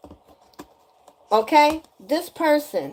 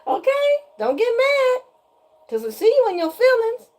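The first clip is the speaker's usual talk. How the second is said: squeaky, much higher mock-innocent voice; high-pitched teasing; 14 semitones higher than usual. Real, not acted. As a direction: tease them way up high, amused. Teasing the person after laughing fit.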